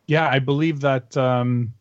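usual speaking voice

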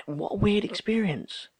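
ordinary delivery

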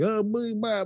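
high, mock-flustered babbling; hammed-up confused Picard; mock disoriented babble. Disoriented Picard babbling as the Borg crowd him, high mock.

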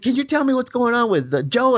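worked-up dad. Retold or imitated. Dad asking paramedic about Joe, worked up. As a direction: imitate a dad seeking a straight answer. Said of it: "He said".

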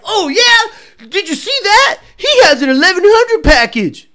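high, loud, awed fanboy; gullible customer. Retold or imitated. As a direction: gush like a sucker who believes it. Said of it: "And you're like".

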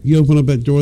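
ordinary talk

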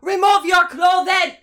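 slow, high-pitched loud bellow; exaggerated warrior yell; yelled Viking command. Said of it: "Just yell everything that he says".

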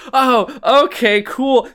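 a hammed-up oblivious hero; breezy Hutter. Hutter shrugs off the werewolf warning, high and slow. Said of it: "So he just goes".

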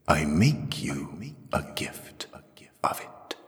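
slow, soft, echoing whisper; whispered offer. A narrator's reading. The voice makes Jirel a gift, whispering. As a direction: let it fade away into a whisper.

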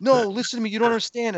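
high-pitched, flustered pleading; urgent retold explaining. Reenacts calming his alarmed girlfriend.

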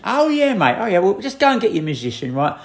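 high, mocking oh yeah mate; the cafe worker doubts his famous guest, sarcastic; sarcastic disbelief. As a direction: scoff at his story in a sing-song.

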